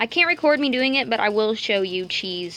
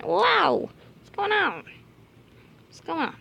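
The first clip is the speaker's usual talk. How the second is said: much higher excited coo; 7 semitones higher than usual. Real, not acted. Greeting the curious bunny, high cooing.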